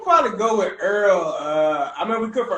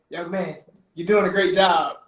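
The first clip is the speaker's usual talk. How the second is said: ordinary talk, nothing marked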